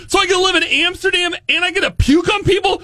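fast, high, gleeful rush; excited. Real, not acted. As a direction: blurt it out thrilled. Excited about puking dream job.

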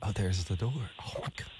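hushed whisper. Real, not acted. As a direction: keep it down, someone's near. Host whispering, stranger entered.

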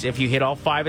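ordinary talk, nothing marked

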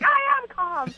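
high, strained insistence of calm; calm turning angry. Retold or imitated. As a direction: insist you're calm while clearly not. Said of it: "and then I'm like".

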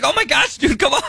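high-pitched, pleading mock exasperation; exasperated uncle, replayed high; retold exasperation. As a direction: plead with the kid in playful disbelief. Uncle exasperated at nephew in retelling.

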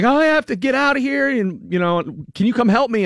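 panicky plea. Retold or imitated. Friend calling in a panic.

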